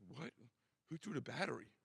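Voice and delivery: playing dumb, echoing the question back; a clueless kid parroting the question, faintly mocked. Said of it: "he's like".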